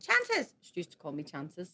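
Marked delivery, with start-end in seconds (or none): 0.1-0.5 imitated teacher. Spanish teacher calling the pupil, high imitated call. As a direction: call the name out like the teacher did. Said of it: "my teacher went".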